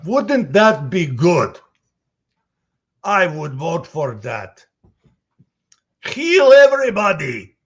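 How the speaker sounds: loud, high, eager proclamation; excited Heavy voice. Acted. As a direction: boom it out with big joy. Character rant about sandwiches.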